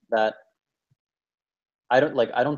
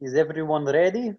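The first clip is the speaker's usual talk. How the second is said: put-on Russian accent again; 4 semitones higher than usual. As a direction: redo the Russian accent so it is clearly heard. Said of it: "the accent, just, I didn't hear it".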